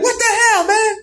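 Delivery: very high indignant squeal; hammed-up high-pitched protest; mock outrage. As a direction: squawk it in disbelief. Thrown man's outraged reaction.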